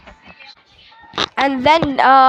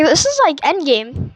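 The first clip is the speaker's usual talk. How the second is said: normal talking voice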